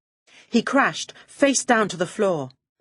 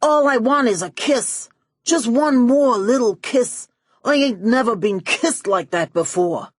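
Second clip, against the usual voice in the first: begging; 5 semitones higher, 4 dB louder than usual. Acted. Max begging Melody for a kiss, high loud lovesick pleading. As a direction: beg for one more kiss.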